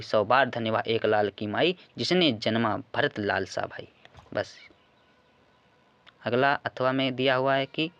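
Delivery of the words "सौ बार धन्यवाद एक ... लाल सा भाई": ordinary delivery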